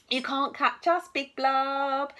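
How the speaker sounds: loud, high taunt called into the dark; teasing call. Acted. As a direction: dare the monster from a safe distance. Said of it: "called out into the shadows".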